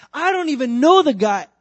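high, loud, desperate shout; shouted denial. Acted. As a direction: yell the denial in panic. Peter denies as eyes lock.

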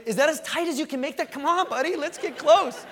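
high, loud anxious urging to the instructor; jittery skydiver begging for a tighter strap; nervous plea. Retold or imitated. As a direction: pull him in tighter, half-panicked. Nervous jumper tugging at the harness.

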